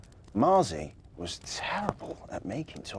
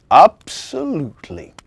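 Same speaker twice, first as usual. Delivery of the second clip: jolly Santa asking; 2 semitones higher, 12 dB louder than usual. A narrator's reading. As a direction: offer the job as a kindly Santa. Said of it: "replied Santa".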